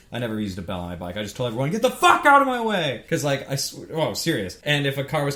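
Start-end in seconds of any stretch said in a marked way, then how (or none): 1.7-3.0 loud, high road-rage shout; angry yell. Retold or imitated. Cyclist telling everyone to move, re-enacted angry shout. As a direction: yell it out at full annoyance.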